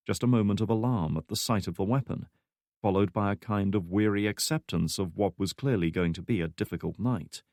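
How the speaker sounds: usual speaking voice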